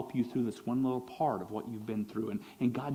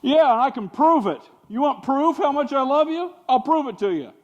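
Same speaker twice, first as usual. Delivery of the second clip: mock Paul; 11 semitones higher, 10 dB louder than usual. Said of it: "Paul brings out".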